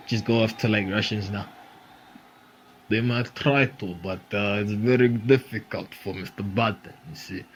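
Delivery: low, soft mock Russian accent; a hammed-up Russian accent, dropped low. Comic to the audience, teasing Biden in a low mock Russian accent. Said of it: "Everyone can do a Russian accent".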